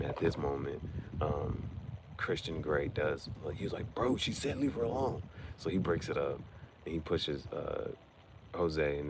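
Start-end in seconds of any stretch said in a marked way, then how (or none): 3.9-5.2 high-pitched retold warning; mock intervention. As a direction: imitate him stepping in, voice raised in pitch. Retold man intervenes to protect her.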